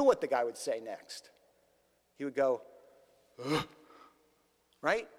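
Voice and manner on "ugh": short dismayed ugh; crestfallen groan. Acted. Rich man hearing the cost, crestfallen groan. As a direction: let out a deflated little grunt. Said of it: "His face fell".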